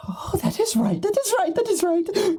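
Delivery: very high, eager repeated affirmations; excited high character voice. Acted. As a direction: chirp it like a gleeful little imp.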